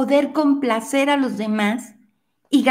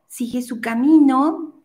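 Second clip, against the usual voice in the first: ordinary talk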